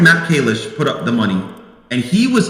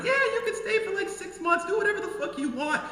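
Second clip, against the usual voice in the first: much higher, overly sweet offer; high, breezy carefree offer; mock generous host; 13 semitones higher, 8 dB quieter than usual. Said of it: "I said".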